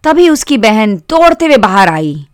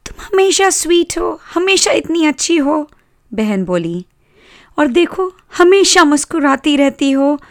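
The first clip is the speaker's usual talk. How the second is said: put-on high-pitched girl praising her doll; high, affectionate sister voice; doting little sister; 7 semitones higher than usual.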